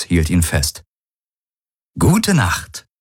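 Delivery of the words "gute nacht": high, gloating good-night; a gleeful taunt with a malicious smile; mock-sweet and gloating. A narrator's reading. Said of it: "mit schadenfrohem Lächeln".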